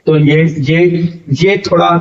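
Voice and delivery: usual speaking voice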